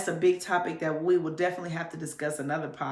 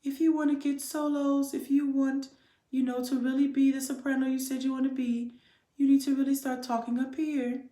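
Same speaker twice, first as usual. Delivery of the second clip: imitating the teacher, pitched much higher; teacher's high voice; 7 semitones higher than usual. Voice teacher urging her to speak higher, high imitation. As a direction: quote the voice teacher up in a high soprano register. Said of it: "So she was like".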